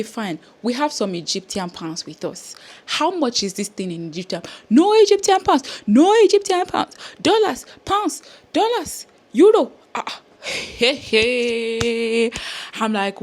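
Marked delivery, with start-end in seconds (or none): 4.7-12.3 high, loud, dismissive official voice; mock officer shouting. Officer rejecting Egyptian pounds, loud high mock refusal. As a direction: bark the currency list like a curt border guard. Voicing the officer listing currencies.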